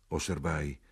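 plain reading voice

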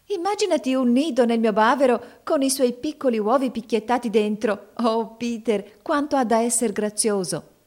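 high, excited storybook voice; character carried away with delight; delighted gushing. A narrator's reading. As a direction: gush over the lovely idea, pitched well up. Delighted character imagining a nest.

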